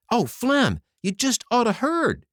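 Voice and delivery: high, loud, bursting with news; can't wait to tell it; eager cry. A narrator's reading. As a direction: soldier rushing up with great news. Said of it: "cried one eagerly".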